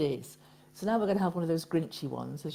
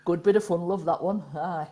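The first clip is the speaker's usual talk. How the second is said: broad, punchy Nottingham dialect; Nottingham accent; 3 dB louder than usual. Shown as a demonstration. Repeating the home dialect for co-host, Nottingham accent. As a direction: land it like a Nottingham local. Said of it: "There's a bit of Nottingham for you".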